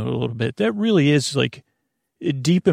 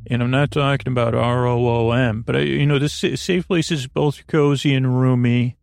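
sleepy radio-style show opener over music; podcast intro. Acted. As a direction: lull the listener in like a bedtime host.

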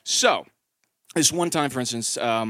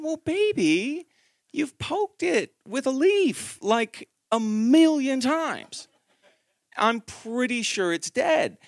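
slow gentle blunt news; 8 semitones higher, slower than usual. Retold or imitated. Dad telling crying daughter the snail is dead, slow and gentle. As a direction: break it to her slowly and kindly.

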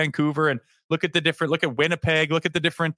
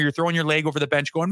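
usual speaking voice

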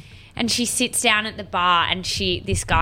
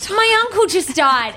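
higher, louder melodramatic cry; mock skit distress; 10 semitones higher, 3 dB louder than usual. Acting skit for Louis, mock melodramatic grief. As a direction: ham up tragic news.

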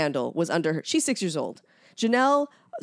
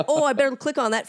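higher, louder put-on keenness; pitched-up mock eagerness; mock eager viewer; 7 semitones higher, 5 dB louder, faster than usual. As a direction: imitate someone taking the clickbait. Voices a viewer tempted to click.

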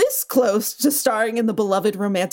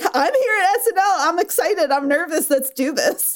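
higher-pitched eager imitation, easing down at the end; excited, nervous; 7 semitones higher than usual. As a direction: imitate a host giddy with nerves. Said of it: "she was just like".

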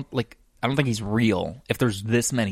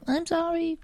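very high, small-voiced sorry; tiny, pitched-up mock apology; mock sheepish apology. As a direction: squeak out a guilty apology. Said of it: "I was more like".

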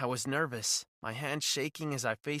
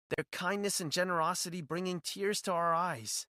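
ordinary delivery

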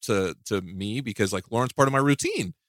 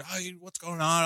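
a hint of the angry sister, higher and softer; mock the sister's call, lightly voiced; imitating sister; 6 semitones higher, 7 dB quieter than usual. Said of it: "She almost sounded exactly like that".

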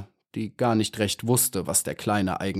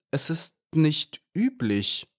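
high, slow and hesitant; mildly bashful little bee, halting between words; shy; 8 semitones higher, slower than usual. A narrator's reading. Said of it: "sagte Maya schüchtern". (schüchtern, hoch und zögernd)

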